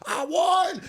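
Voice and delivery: very high, drawn-out victory yell; imitated gloating victory cry; triumphant shout. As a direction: shout it like a winner, slowly stretched out. Imitates rival celebrating a win.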